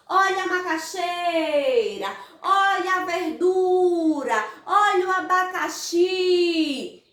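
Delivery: market vendor's cry. Acted. Vendors offering products at the fair, sing-song hawking. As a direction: call out produce, stretching the final stressed syllables. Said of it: "os vendedores oferecendo os produtos".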